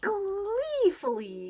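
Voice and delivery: high, loud, slow gleeful word; gleeful read. A narrator's reading. Reading to children, savouring the gleeful word. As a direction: stretch it out with glee.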